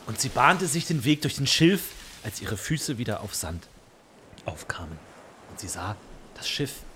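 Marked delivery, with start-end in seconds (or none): 2.2-6.8 storyteller whispering; whispered narration. A narrator's reading. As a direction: hush it like a secret.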